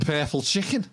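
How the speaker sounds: Scouse "purple chicken", rising at the end; Scouse accent. Shown as a demonstration. Trading Scouse takes with co-host, Scouse accent. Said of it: "how do you know someone's a Scouser".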